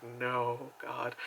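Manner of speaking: very soft, low plea; quiet dread. Retold or imitated. Viewer watching a creepy kid scene, hushed dread. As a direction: murmur it under your breath.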